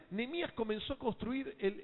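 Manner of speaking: usual speaking voice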